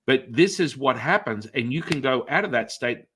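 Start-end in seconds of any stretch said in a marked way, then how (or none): none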